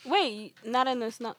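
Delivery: ordinary delivery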